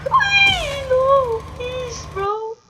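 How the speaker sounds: high panicked no, pleading; panicked cry. Real, not acted. About to lose the round.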